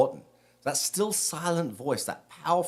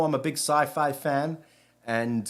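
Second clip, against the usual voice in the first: ordinary talk, nothing marked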